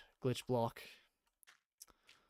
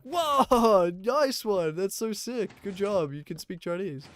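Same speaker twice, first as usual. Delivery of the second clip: much higher, much louder mock praise with a laugh; gushing locals; 7 semitones higher, 8 dB louder, slower than usual. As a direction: rave over a tiny bit of Chinese. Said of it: "they're like".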